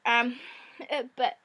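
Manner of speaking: hoarse, croaky sore-throat voice. Real, not acted. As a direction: talk through a croaky throat. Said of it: "still with her croaky voice".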